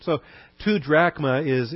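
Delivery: ordinary delivery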